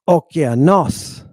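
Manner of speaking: "okeanos" slow, extra time on "nos"; stress on last syllable. Shown as a demonstration. Teacher correcting the accent, final syllable stressed. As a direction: lengthen the closing "nos" of "okeanos". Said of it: "putting a little extra time on that syllable".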